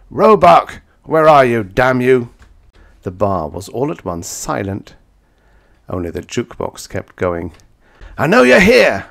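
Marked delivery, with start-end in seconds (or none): 0.1-2.3 loud, high furious roar. A narrator's reading. He roars at his enemy across the silent bar. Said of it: "kicked over my chair and roared". 8.2-9.1 high, loud screamed challenge. A narrator's reading. He screams at Roebuck hiding in the men's room. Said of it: "I screamed".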